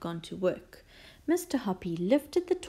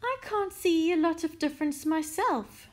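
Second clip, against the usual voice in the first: airy, offhand reply in a raised pitch; casual; 9 semitones higher than usual. A narrator's reading. Mister Hoppy answering Missus Silver, casually. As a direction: play it down casually. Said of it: "Mister Hoppy had answered casually".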